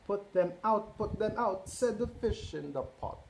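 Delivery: lively picture-book read-aloud; reading to children. A narrator's reading. Parent reading Cat in the Hat to children, lively. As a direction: read it out to a roomful of kids.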